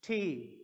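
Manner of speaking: "थी" falling, with a breathy release; foreigners' T. Shown as a demonstration. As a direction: say T as "थी" with a puff of air. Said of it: "foreigners pronounce करते हैं इसको".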